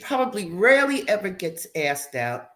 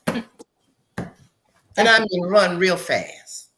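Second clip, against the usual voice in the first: slow emphatic; 4 dB louder, much slower than usual. Acted. Urging her to flee.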